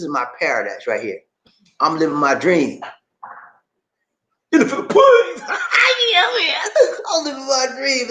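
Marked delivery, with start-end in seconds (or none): 4.5-8.1 high exultant shout with a laugh; exultant cry of happiness; joyful shout. Real, not acted. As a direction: shout it out, overjoyed. Exults about living his dream.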